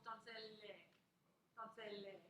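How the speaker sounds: "donzelle" said with its final syllable fully sounded as "leh"; sounded last syllable. Shown as a demonstration. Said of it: "missing is the last syllable".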